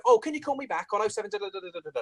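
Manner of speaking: gabbling the digits quickly; fast mumbled number. Retold or imitated. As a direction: rush through the phone number. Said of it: "'Cause they then go".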